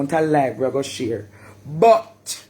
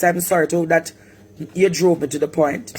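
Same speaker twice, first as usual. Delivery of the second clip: usual speaking voice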